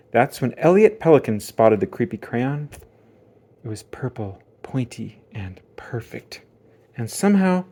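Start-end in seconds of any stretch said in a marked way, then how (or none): 3.6-6.4 hushed. A narrator's reading. Ominous quiet final reveal.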